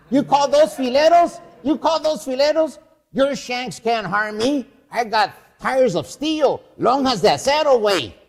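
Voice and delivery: put-on swaggering Falstaff-style braggart bellowing at the kids; Fausto waking up. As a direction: roar it out like a drunken thief king. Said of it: "It's Fausto".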